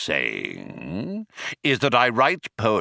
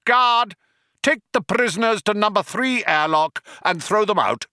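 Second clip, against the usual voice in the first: raised, forceful villain tirade; commanding Vogon; 7 semitones higher than usual. A narrator's reading. Vogon ordering prisoners thrown out, harshly commanding. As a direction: pitch it up and shout for the guard.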